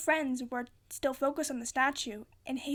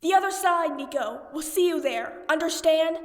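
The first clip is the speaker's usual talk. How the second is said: loud urgent shout, higher; 4 semitones higher, 9 dB louder than usual. A narrator's reading. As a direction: call up to a friend far above. Percy shouting up to Nico.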